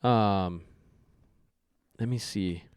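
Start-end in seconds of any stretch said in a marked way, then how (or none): none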